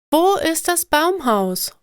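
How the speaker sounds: put-on a kid impatient to find the treehouse; child asking. A narrator's reading. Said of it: "frage ich". (höhere, neugierige Kinderstimme)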